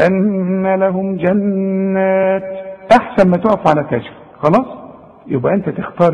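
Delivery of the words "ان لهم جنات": slow drawn-out Quran recitation, pausing at جنات. Shown as a demonstration. Teacher showing a student where to pause, slow recitation. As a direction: recite it slowly as in tajweed class. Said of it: "تقول".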